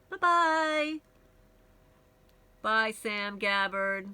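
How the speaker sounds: sing-song, drawn-out goodbye; sarcastically sweet send-off; mock farewell. Real, not acted. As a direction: wave off a banned troll with a lilting bye-bye. Mock farewell to banned commenter.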